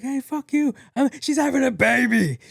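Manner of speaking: high, mock-furious holler; yelling at drivers. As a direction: clear the road, shouting. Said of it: "people were mad".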